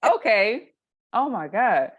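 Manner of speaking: high, loud, laughing amazement; excited laughing. Retold or imitated. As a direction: gush in delighted disbelief. Realizing news anchors talk normally.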